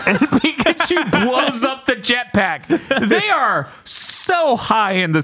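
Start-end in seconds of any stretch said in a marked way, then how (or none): none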